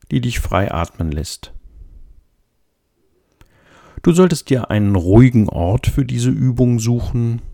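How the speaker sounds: usual speaking voice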